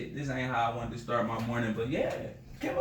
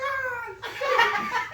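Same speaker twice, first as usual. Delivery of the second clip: high startled scream; 5 dB louder than usual. Retold or imitated. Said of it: "I was like".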